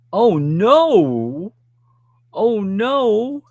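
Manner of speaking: loud, drawn-out "oh no"; dismayed sympathy. Real, not acted. Host hears about the cat's smash, dismayed. Reacting to viewer's cat mishap.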